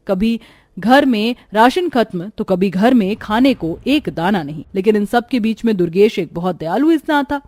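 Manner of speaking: ordinary delivery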